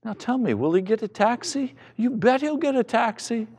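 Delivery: ordinary delivery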